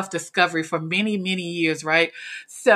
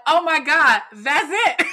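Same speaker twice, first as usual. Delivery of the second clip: higher, excited realization; revelation; 8 semitones higher, slower than usual. Retold or imitated. Hearing the attendee's words, excited realization. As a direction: it finally clicks, light up.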